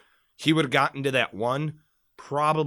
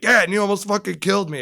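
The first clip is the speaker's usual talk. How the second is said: fast, higher, louder snap; retold angry snap; 9 semitones higher, 8 dB louder, faster than usual. Telling the careless buddy off after the knife fell, retold anger. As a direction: fire it back at him, fed up.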